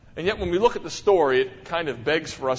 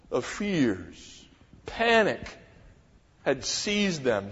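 ordinary talk

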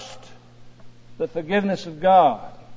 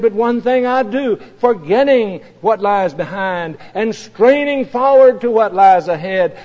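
preaching at full force; 3 semitones higher, 7 dB louder than usual. Acted. Preacher rousing the congregation with Paul's words, full force. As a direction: build it like a sermon's peak.

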